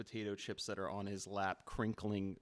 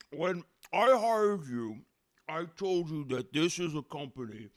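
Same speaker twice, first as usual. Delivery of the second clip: loud boss with a mouthful; mouth full; 5 semitones higher, 9 dB louder than usual. Acted. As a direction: lecture through chewed chips. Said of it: "with a mouthful".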